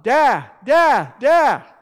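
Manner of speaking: put-on high, loud child's yell for dad. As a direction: call out like a toddler wanting his father. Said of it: "to yell out".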